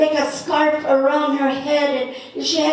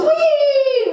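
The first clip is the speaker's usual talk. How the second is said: a high, loud squeal of glee; gleeful whee; 12 semitones higher, 5 dB louder than usual. Retold or imitated. Ducking a thrown rock, a high gleeful squeal. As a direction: squeal as the rock whizzes past. Rock flies over her head.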